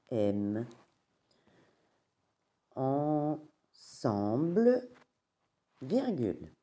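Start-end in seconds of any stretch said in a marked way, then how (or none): 2.7-4.9 Ensemble drawn out slowly, each syllable separated; stretched syllables. Shown as a demonstration. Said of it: "slow syllabified model".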